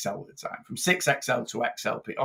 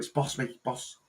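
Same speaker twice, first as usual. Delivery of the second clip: mock Scouse accent; 8 dB quieter than usual. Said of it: "a very common phrase in Liverpool".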